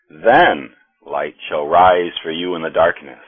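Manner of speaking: ordinary delivery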